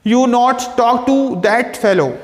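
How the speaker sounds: slow dictation. Shown as a demonstration. Teacher reads example sentence slowly.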